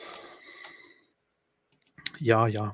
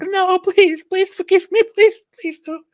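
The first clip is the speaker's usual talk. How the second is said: very high, loud begging squeal; mock terrified falsetto shriek; hammed-up screaming; 9 dB louder than usual. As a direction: beg for mercy in a squeaky cartoon panic. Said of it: "the stone started screaming like".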